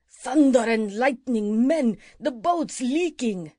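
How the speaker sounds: slow, loud bellow of alarm. A narrator's reading. Pirate leader bellowing to his men that the boat leaks. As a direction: roar it out like a startled pirate captain. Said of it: "suddenly bellowed out".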